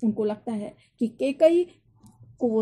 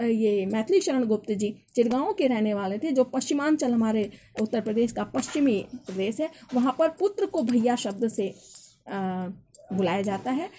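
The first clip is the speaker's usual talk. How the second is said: ordinary delivery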